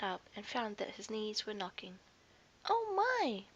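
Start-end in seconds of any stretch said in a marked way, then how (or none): 2.7-3.4 high startled gasp; shaken oh my. A narrator's reading. Faetua shaken after the elephant's blast, high startled. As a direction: knees still knocking.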